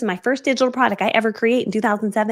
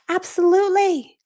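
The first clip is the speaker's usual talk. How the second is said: "Absolutely" higher, slow and sing-song; gushing professional; 9 semitones higher, 4 dB louder than usual. Retold or imitated. Mom answering a CEO with slow, high, polished "Absolutely". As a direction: stretch out "Absolutely" brightly to sound polished. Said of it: "Act like this is normal".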